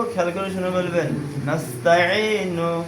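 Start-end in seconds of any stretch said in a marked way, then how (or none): 1.4-2.8 "নাস্তাঈনু" as one connected, slow word; slow model reading of whole "নাস্তাঈনু"; joined-up syllables. Shown as a demonstration. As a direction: recite "নাস্তাঈনু" without breaks, long ee. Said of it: "আমি ওখানে একসাথে বলবো".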